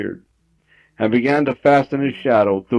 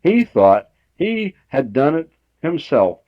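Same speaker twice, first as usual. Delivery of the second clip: ordinary delivery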